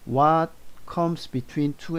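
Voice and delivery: "What comes between two and four" spoken slowly, word by word; slow model sentence. Shown as a demonstration. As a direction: read it slowly so students can follow. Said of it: "what으로 물어보면 있잖아요". (천천히 또박또박 읽는 예문)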